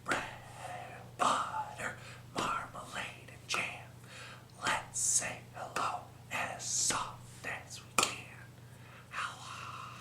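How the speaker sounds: soft whisper all the way through. Shown as a demonstration. Librarian leading kids, whole rhyme whispered softly. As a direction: hush it like a secret. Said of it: "the last one we're going to do softly".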